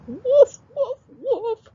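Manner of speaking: high, loud imitated barks; dog barking. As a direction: bark like an excited dog. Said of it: "barked Mister Dog".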